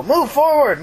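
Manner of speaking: high-pitched urgent command, stress on "forward"; radio order shout. Retold or imitated. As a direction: call out the order, leaning on "forward".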